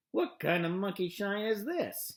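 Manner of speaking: grumpy rat's growl; grumble, woken rudely. A narrator's reading. The rat to Wilbur, growling grumpily. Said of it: "he growled".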